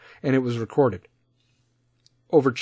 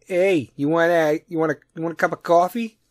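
mock East Coast Jersey coffee offer; Jersey accent; 3 semitones higher than usual. Jersey guy offering the Chicago host coffee, mock Jersey accent. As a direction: put on a Jersey accent, slightly higher. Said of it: "like, Jersey East Coast accent".

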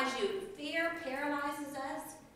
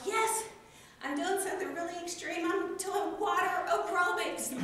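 high, loud, thrilled self-quote; excited; 7 semitones higher, 5 dB louder than usual. Retold or imitated. Speaker recalling her class plans, excitedly. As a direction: gush about the big plan. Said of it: "I was so excited".

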